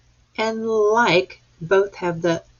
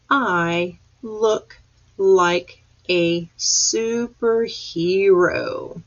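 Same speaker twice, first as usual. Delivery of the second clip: slow reading; much slower than usual. Shown as a demonstration. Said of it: "Let's read that one more time".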